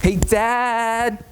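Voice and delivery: put-on high, slurred drunk greeting; drunk call. Drunk son calling Dad, high and slurred. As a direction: slur it happily. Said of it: "How it actually went".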